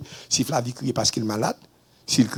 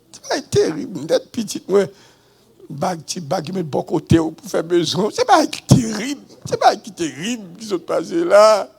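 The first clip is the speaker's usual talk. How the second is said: raised voice over how bad it is; exclaiming; 7 semitones higher, 6 dB louder than usual. Real, not acted. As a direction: cry out in disbelief, stretching the words. Exclaiming over the heavy baggage.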